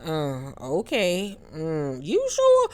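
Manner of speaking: drawn-out unconvinced reactions, squeaking up at the end; skeptical. Acted. Host mimicking a doubtful stare at a castmate, skeptical. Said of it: "giving her the ... face".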